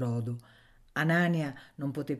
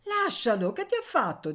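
put-on much higher girl's voice; bossy little girl; 11 semitones higher, 4 dB louder than usual. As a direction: stand up for the boy. Girl defends Anania. (bambina prepotente, voce molto più alta)

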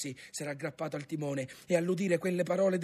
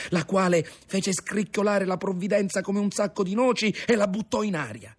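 ordinary delivery, nothing marked

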